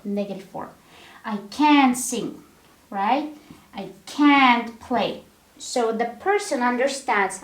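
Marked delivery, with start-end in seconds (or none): 1.2-2.4 "can't" held long and strong, "sing" falling away; stress on CAN'T. Shown as a demonstration. Teacher demonstrating the stressed modal verb, weight on "can't". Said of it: "So we stress this modal verb". 3.8-5.2 slow, with "can't" drawn out and strong; stress on CAN'T. Shown as a demonstration. Teacher demonstrating negative form to learners, stress on "can't". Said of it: "So we stress this modal verb".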